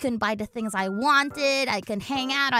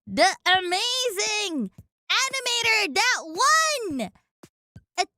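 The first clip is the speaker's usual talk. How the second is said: excited hype; 7 semitones higher than usual. Real, not acted. Streamer hyping an animator in chat, shouting. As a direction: hype the guest like a host.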